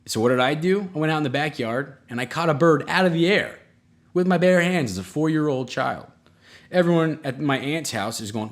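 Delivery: ordinary talk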